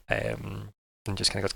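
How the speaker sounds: normal talking voice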